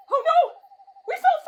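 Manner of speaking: loud, high wail; panicked dismay. Acted. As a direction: cry out in dismay. Panics after falling through fog.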